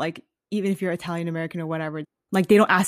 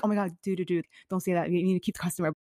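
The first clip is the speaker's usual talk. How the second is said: hushed warning; 7 dB quieter, faster than usual. Retold or imitated. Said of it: "And my aunt had to be like".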